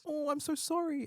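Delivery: high, fake-sorry girly voice; squeaky sympathetic coo; high mock sympathy.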